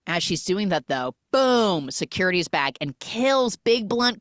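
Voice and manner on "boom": ordinary talk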